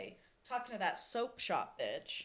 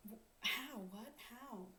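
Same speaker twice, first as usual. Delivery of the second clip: bewildered; 4 semitones higher, 14 dB quieter than usual. Retold or imitated. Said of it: "She's like".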